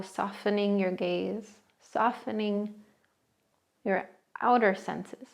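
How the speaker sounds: soothing guided meditation voice. Acted. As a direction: settle the listener in, unhurried. Leads a seated meditation.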